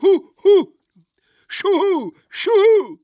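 very high, loud owl-like hoots; hooting heckle. A narrator's reading. Heckler mocks the orator from the table's end, hooting. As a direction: hoot loudly in a piercing high voice. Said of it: "Wer hatte da eben geschrien?".

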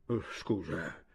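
low, soft apology; under his breath. A narrator's reading. Chojnicki apologising to his Slovene friend, under his breath. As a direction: drop to an undertone. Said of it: "disse sottovoce".

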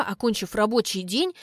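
usual speaking voice

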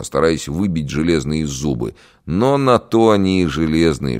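ordinary delivery, nothing marked

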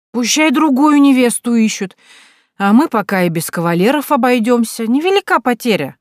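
high, loud smug hiccuping voice; smug tipsy. Acted. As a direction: grin through it, pleased and tipsy. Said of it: "икнула и растянула губы в довольной улыбке". (довольно, навеселе)

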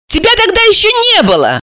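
high-pitched puzzled objection; surprised. A narrator's reading. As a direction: point it out in surprise. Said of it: "удивилась Алиса".

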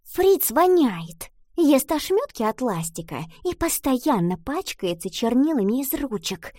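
girl's mock whisper. A narrator's reading. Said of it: "прошептала".

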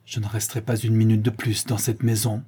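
usual speaking voice

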